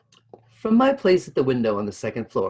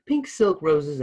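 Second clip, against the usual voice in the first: ordinary delivery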